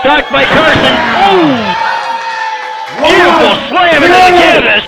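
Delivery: very high shouted call; excited shout. Real, not acted. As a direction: scream the big slam. Big slam called.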